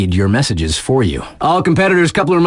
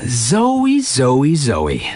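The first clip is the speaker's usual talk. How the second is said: sing-song calling; much slower than usual. Acted. Radio DJ hyping snowboarder Zoe, sing-song name calling. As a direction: call her name three times, dropping each time.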